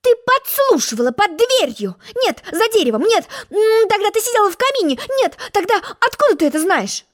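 high, rapid-fire accusations; pompous egg interrogating a girl; suspicious accusing egg. Acted. Humpty accusing Alice.

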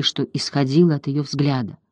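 usual speaking voice

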